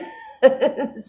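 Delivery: high falling laugh; short laugh. Real, not acted. Laughing at own failure.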